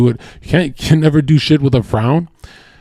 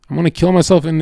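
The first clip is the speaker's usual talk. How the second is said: ordinary talk, nothing marked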